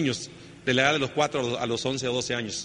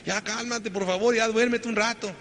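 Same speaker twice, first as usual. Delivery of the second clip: weary pleading; 6 semitones higher, slower than usual. Retold or imitated. Dad begs kids to sleep.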